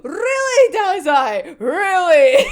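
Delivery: loud high disbelieving really; half-laughing loud disbelief; mock outraged disbelief; incredulous. Incredulous reaction to Dazai.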